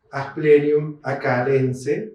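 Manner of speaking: "acalense" with a K, not CH; slow, Latinized "acalense". Shown as a demonstration. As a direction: say "Asplenium acalense" slowly, hard K. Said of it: "por más que venga de Pampa de Achala".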